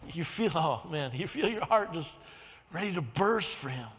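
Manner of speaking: ordinary talk, nothing marked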